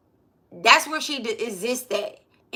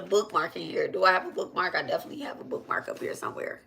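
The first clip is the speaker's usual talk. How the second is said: muttered aside; 4 semitones lower, 8 dB quieter, much faster than usual. Real, not acted. Searching desk aside.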